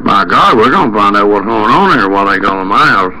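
ordinary talk

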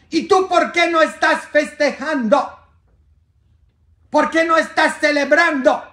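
high, loud questioning angel voice; angel's question. Acted. Angel asking a weeping God, high and loud. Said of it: "se le acerca un ángel y le dice".

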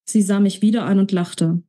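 usual speaking voice

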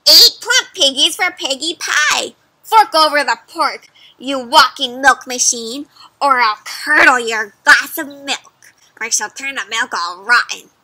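loud menacing witch; threatening witch. Acted. Witch threatening the cow over the piggies, loud. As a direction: threaten to curdle the milk.

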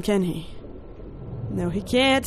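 normal talking voice

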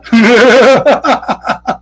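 high-pitched laughing fit; long, high derisive laugh; scoffing laugh. Real, not acted. Laughs at the quoted claim.

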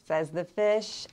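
usual speaking voice

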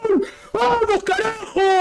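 screaming goal, high and strained; goal celebration shout. Real, not acted. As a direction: yell it at the top of your lungs.

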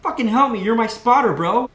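high, loud exasperated plea; retold plea for help. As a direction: yell for the spotter under a stuck bar. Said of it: "And I'm like, Darcy".

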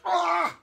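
high, loud repeated cries; two high wailing yells; pained yell. A narrator's reading. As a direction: cry out as if being twisted. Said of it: "yelled Haley".

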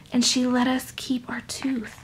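soft whisper. Real, not acted. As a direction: hush it like a secret. Whispered repeat.

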